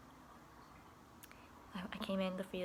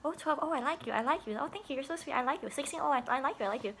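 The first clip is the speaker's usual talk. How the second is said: higher, sing-song teasing approval; playful approval; 6 semitones higher, 4 dB louder, faster than usual. Pretend. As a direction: coo it like a teasing compliment. Joking age-guess game with kids.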